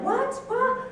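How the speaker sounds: high, stunned double "What?"; retold shock, high and incredulous; shocked disbelief. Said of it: "That was exactly my reaction".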